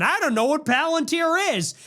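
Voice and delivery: lightly voiced Tucker pretending not to know; mock Tucker. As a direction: mimic a pundit playing dumb. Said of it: "Tucker goes on Theo Von".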